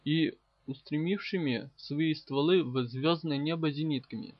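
usual speaking voice

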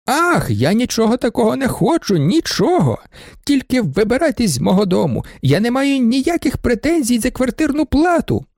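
high, shrill-pitched outcry; shouting. A narrator's reading. Baroness shouts at the students to move out. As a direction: cry out to be rid of them. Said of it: "закричала баронеса".

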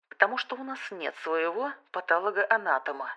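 тихо и буднично; ответить как о мелочи. A narrator's reading. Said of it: "буднично ответил он". (будничный тон)

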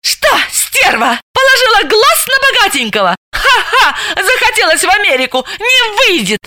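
high, mocking insults with a cackle; издевательски; jeering abuse. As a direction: taunt her rival with scorn. Jealous woman insults rival.

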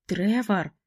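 ordinary delivery

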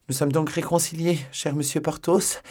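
high, coy simpering voice. Acted. La procureuse minaude auprès de Porthos, voix haute. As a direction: simper sweetly at him. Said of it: "dit-elle en minaudant".

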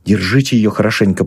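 ordinary delivery

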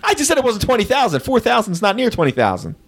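pitched well up in protest; indignant rebuttal. Real, not acted. Host defending his clue to a complaining guesser, indignant. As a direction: push back, high and incredulous.

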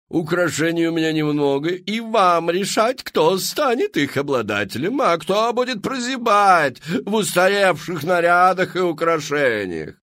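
high, loud, theatrical madame pitching to the crowd; showy saleswoman. Acted. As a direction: sell it like a grand Parisian hostess. Мадам Фру-Фру. (высокий громкий голос мадам-зазывалы)

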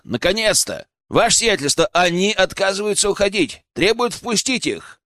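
high, urgent reporting voice; calling out. A narrator's reading. Guard reports intruders from behind the gate, calling out. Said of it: "раздался крик из-за ворот".

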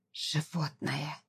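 hushed, slow hiss of rage; furious hiss. A narrator's reading. Wife repeats the insult to her husband, hissing quietly in fury. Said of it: "всё так же яростно повторила Зара".

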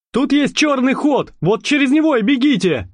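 urgent gendarme. A narrator's reading. Gendarme urging escape.

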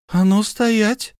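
high, loud, slow halt order; wife stamping her foot, shouting stop; sharp command. Acted. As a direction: stop him in his tracks. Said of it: "топнула ногой".

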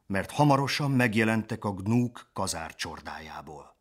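ordinary delivery, nothing marked

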